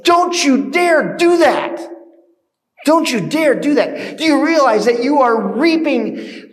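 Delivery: high, loud outraged warning; acted indignation. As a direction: rebuke them as if rushing in to stop it. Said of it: "who are so indignant".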